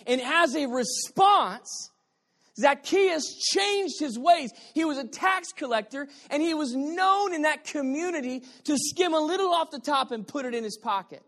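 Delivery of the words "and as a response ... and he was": rising shout. Real, not acted. Preacher building to the climax.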